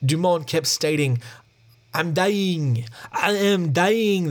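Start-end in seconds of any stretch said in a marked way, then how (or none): 1.9-4.3 slow, raised-pitch mock French accent; badly faked French accent, words stretched out. Wounded Frenchman telling the ranger, slow hammy French accent. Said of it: "That's my French accent".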